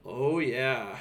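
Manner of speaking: ordinary talk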